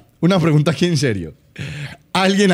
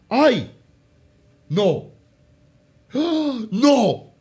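shocked denial; 3 semitones higher, 3 dB quieter, slower than usual. Real, not acted. Reacts in shock to what he sees.

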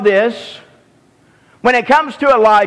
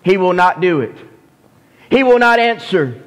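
usual speaking voice

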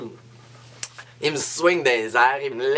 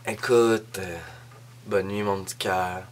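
tender goodnight; 6 semitones lower, 5 dB quieter than usual. Retold or imitated. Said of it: "genre de gars qui dit".

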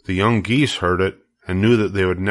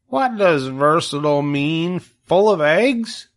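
put-on high, hopeful young pig voice, slow and pleading; a piglet eagerly asking his friend along; Wilbur the pig; 8 semitones higher, slower than usual. Wilbur asks Charlotte to come, high hopeful piglet voice.

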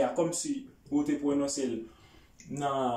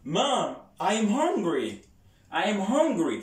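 Mom raised high, hungry stressed and falling; with intonation; 4 semitones higher than usual. Shown as a demonstration. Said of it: "Fò itilize entonasyon".

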